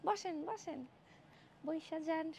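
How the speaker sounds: very soft voice. Shown as a demonstration. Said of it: "আস্তে কথা বলতেছি". (খুব আস্তে, চাপা গলায়)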